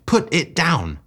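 a sharp order cried after him. A narrator's reading. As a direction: yell at him to drop it. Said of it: "I cried".

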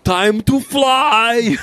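high, loud, drawn-out cartoon cry; big idiot Sasquatch. Hallucinated Sasquatch announcing takeoff to his son, idiot voice. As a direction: stretch it out like a huge goofy creature. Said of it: "like, this huge idiot".

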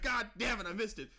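high, loud mock frustration; yelling because he missed it; frustrated yell. Friend cursing the missed moment, in a high loud yell. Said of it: "I was like".

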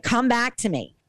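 high-pitched mild mock yell; retold yell. Wife calling unconscious husband back, retold mild yell. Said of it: "And then I yelled at him".

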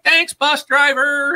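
high, slow robotic Claptrap voice; squeaky little robot thanking the driver. Retold or imitated. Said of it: "Or sometimes he's like".

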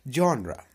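जॉनरा said jawn-ra with ra at the end; जॉनरा, ending on a clear ra; correct genre. Shown as a demonstration. Teacher models जॉनरा, ra ending. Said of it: "रा आखिर में लगेगा".

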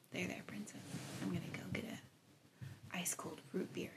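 hushed storytelling. Acted. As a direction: draw them into the scene. Paints hushed storytelling scene.

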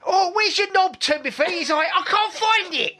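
put-on high silly child voice; film kids' voices. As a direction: squeak it like a posh film kid. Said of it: "He goes, they're like".

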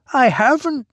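high, thin echoing reply; thin reedy voice. A narrator's reading. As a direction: echo it back high and reedy. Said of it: "he echoed".

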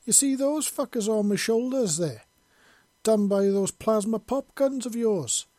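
heated Scottish soldier pointing at his wounds; angry showing scars. A narrator's reading. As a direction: jab at each scar as he names it. Shows scars, angry Scottish rant.

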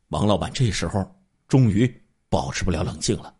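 ordinary delivery

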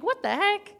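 a burst of mock outrage, pitch jumping up; indignant disbelief. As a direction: throw the question back, stunned and offended. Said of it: "and I was like".

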